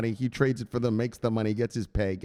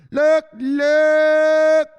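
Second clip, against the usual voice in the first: much higher, very loud look; excited calling out; 11 dB louder than usual. Real, not acted. Showing chat a viewer, very loud high call. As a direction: grab everyone's attention.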